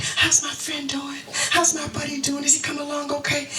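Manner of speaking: put-on kids asking. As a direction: voice the boys asking about a friend. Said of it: "all three boys were like".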